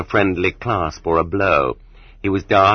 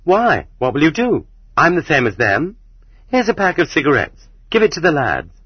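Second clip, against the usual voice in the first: put-on higher-pitched, cheeky girl's voice; defiant girl; 7 semitones higher than usual. A narrator's reading. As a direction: answer the guard back boldly, pitched up. Defiant girl answers the bellowing guard.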